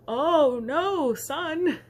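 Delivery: put-on calm the scared boy down; reassuring dad. Dad telling his son the women aren't monsters, reassuring.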